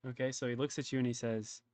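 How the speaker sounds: usual speaking voice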